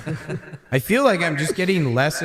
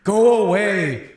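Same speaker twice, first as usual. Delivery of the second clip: slow, higher mock scold from a window; annoyed neighbor yelling; 6 semitones higher, 3 dB louder, slower than usual. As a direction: scold them like a fed-up neighbor. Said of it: "is like".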